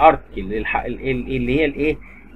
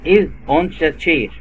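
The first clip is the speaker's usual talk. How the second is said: slow model sentence; much slower than usual. Shown as a demonstration. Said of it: "repeats model".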